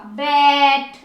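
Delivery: बैट with a long, held "ai"; long vowel. Shown as a demonstration. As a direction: stretch the vowel in बैट before the T. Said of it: "sound को लंबा खींचेंगे". (बैट में "ऐ" लंबा खींचें)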